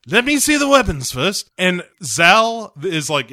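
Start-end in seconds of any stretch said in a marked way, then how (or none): 0.1-1.5 high, hammy British spy voice; imitation secret agent, pitched up; James Bond. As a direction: demand it as a mock British agent. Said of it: "Bond's like".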